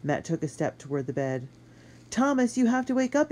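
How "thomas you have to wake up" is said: high, loud plea to wake; urgent shout. A narrator's reading. Boy trying to wake his friend, high urgent shout. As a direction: shout to rouse someone. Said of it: "he shouted".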